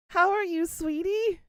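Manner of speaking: high, loud, sweet greeting with HOW lifted; gushing greeting. Real, not acted. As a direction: gush over a dear friend.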